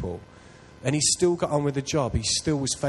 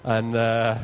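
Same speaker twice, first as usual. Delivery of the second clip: normal talking voice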